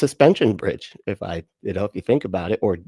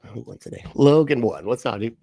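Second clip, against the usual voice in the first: ordinary delivery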